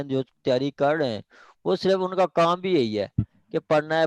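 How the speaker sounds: usual speaking voice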